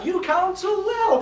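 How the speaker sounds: high-pitched emphatic agreement; emphatic approval. Acted. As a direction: lift the voice high to praise the advice.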